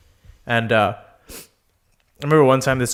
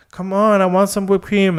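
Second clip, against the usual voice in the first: put-on higher, pleading girly voice; mock whiny girl; 9 semitones higher, 4 dB louder than usual. Drunk girl begging the guy for more cream, higher whiny plea.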